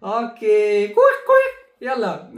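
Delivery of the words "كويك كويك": high quacking duck sound. Pretend. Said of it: "كيف صوت ال-، البطة؟". (تقليد صوت البطة)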